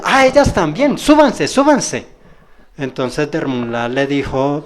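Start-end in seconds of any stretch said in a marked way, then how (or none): 0.0-2.1 retold eager urging. Master urging, quoted.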